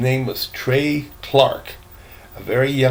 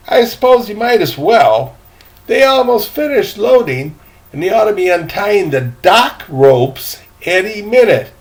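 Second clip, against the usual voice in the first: put-on high, louder boy's voice; eager boy; 8 semitones higher, 8 dB louder than usual. Boy telling the gentleman about the captain, high and eager. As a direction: play the cheerful young deckhand. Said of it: "said the boy".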